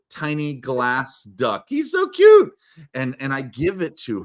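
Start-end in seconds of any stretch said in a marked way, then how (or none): 1.7-2.5 high, loud squeal of adoration; gushing. Real, not acted. Showing off a tiny glass duck, gushing in a high squeal.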